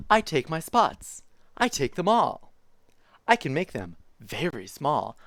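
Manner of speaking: lively picture-book reading voice; reading to children. Acted. Streamer reading a zoo picture book to chat, bouncy storytime voice. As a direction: read it like storytime for little kids.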